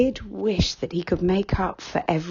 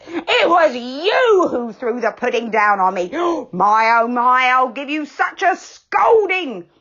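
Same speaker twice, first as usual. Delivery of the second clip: louder, higher furious threat; What's-His-Name threatening Moonface; angry threat; 7 semitones higher, 5 dB louder than usual. A narrator's reading. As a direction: gasp then threaten.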